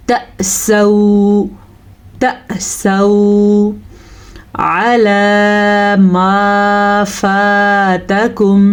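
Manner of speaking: slow, drawn-out tajweed chant; slow melodic Quran recitation; Quranic recitation. Shown as a demonstration. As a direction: recite with long, measured vowels. Recites verse slowly.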